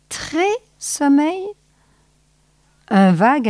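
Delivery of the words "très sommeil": wife voice. A narrator's reading. Wife asks back.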